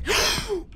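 high startled gasp; a quick high intake of breath at a fright; sharp gasp. Real, not acted. Gamer startled by the latex monster, high gasp.

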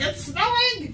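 excited. Retold or imitated. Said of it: "It was like".

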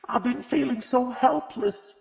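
high, slow, tearful plea. A narrator's reading. Grieving mother tells Agatha she feels helpless, tearfully. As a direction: let the tears break through the words. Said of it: "tears now coursing freely".

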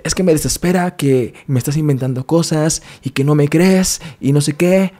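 high, agitated mock rant; worked up. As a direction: imitate an overwrought complaint, pitched well up. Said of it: "estaba de que así exaltado".

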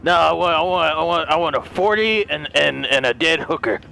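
very deep, disreputable Santa; seedy mall Santa. Acted. Santa answering what to leave by the fireplace, seedy. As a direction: sink right down into a shady Santa voice.

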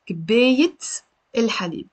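كباية ending in -it, pause, الحليب; T pronounced separately. Shown as a demonstration. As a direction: break it down after the T. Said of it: "I have to say".

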